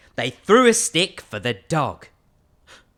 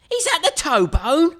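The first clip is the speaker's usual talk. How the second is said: higher, louder questioning voice; puzzled little skeleton; 12 semitones higher, 5 dB louder than usual. Acted. Little skeleton unsure which bone this is, high voice. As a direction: ask it confused.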